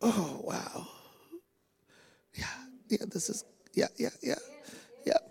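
ordinary delivery

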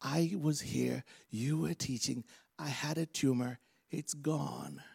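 hushed, halting report; awed testimony. Acted. Healed person interrupting service to testify, hushed. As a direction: tell it softly, phrase by phrase. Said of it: "they come back interrupting the service".

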